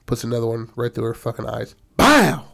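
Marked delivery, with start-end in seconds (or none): 2.0-2.4 high, loud mock gunshot "bow"; gunshot sound. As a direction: bark out a gunshot noise. Second gunshot acted out.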